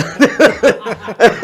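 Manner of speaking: loud, high burst of laughter; laughing while retelling the joke; big laugh. Real, not acted. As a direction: laugh hard at your own story.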